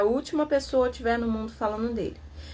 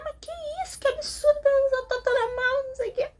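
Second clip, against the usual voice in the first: very high, whiny mock-indignant voice, near tears; mock dramatic lament; faster than usual. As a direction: ham up the fussy colleague's fake outrage, almost crying. (lamento exagerado e choroso, voz bem aguda)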